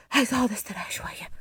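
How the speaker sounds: breathy whisper, rushed; عايزة أقعد أستريح شوية whispered fast and breathless; tired whisper. Acted. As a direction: whisper it, worn out from walking. Exhausted fugitive begs to rest.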